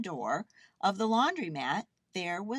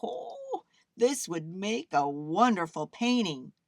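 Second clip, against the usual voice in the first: inspired; 2 semitones higher, 4 dB louder than usual. A narrator's reading. Artist watching the spinning clothes, inspired. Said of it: "the artist became inspired".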